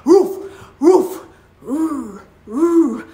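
rhythmic woofs, loudest at first; imitated dog barks. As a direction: bark out the dance beats. Dog barks the final routine.

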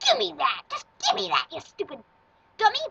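loud, snapping grab for it; mock angry demand. Grabbing item, insulting someone.

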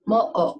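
mo'ok broken by an abrupt throat catch; glottal stop. Shown as a demonstration. Teacher repeating the word for duck, mo'ok split in two. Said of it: "a catch or an abrupt stoppage".

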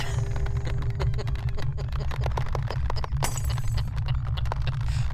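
high loud laughing fit; loud laughter. Real, not acted. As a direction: burst out laughing.

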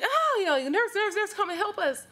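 high, loud, desperate call; calling for help. Retold or imitated. Sisters call the nurse as mother fails, loud and high. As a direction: cry out down the hall for the nurse.

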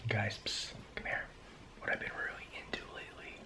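hushed secret whisper to viewers. Real, not acted. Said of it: "I don't know why I'm whispering".

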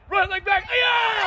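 loud high shout; shouted kiai. Shown as a demonstration. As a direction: yell it from the belly. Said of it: "you say "Aya".

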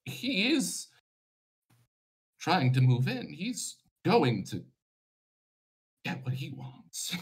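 a giant warning, alarmed; agitated giant. Acted. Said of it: "the Stone Giant acts up".

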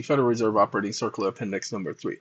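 deep, fast announcer read of "Operating Circular"; deep host persona. Acted. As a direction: read the title quickly in a deep voice. Deep persona reads title.

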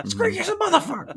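very high put-on shriek; imitated yelling. Teller repeats shopper yelling at a stranger, high mock yell. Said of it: "but just yelling at a stranger".

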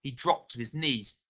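ordinary delivery, nothing marked

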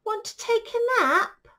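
high, loud storybook cat; cat's voice. Acted. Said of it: "cried the cat".

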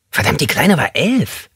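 high, thin, squeaky man's voice; squeaky-voiced inmate joking with the group. A narrator's reading. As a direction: pipe it up high like a small squeaky man. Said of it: "mit seiner piepsigen Stimme".

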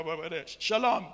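ordinary delivery

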